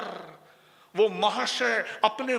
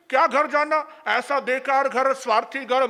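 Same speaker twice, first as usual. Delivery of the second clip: ordinary talk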